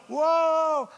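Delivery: high, loud, drawn-out whoa; mock alarmed whoa. Preacher imitating sailors holding on as the boat heels, mock alarm. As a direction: cry out as if the boat is tipping.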